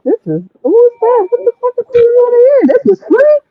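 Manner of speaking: can't believe what you're hearing, this is free; stunned disbelief. Retold or imitated. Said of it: "and I was like".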